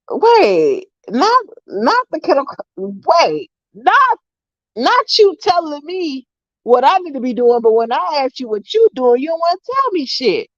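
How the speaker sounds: high-pitched mock outrage; incredulous comeback. Granddaughter calling out grandma's nagging, incredulous. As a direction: call out the double standard, pitched high.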